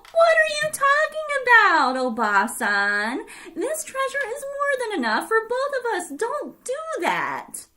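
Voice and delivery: high, loud, anxious plea; alarmed old man crying out; pleading cry. Acted. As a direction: beg her not to go. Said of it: "Oji-san cried".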